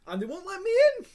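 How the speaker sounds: sudden high, loud, fast protest; high, loud indignant burst; indignant outburst. Real, not acted. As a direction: blurt it out in disbelief. Indignant outburst, big pitch jump.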